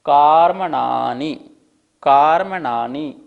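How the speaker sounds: कार्मणानि loud and slow, each syllable clear; emphatic कार्मणानि; slow emphasis. Shown as a demonstration. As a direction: hammer out कार्मणानि for the class. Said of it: "कार्मणानि तक".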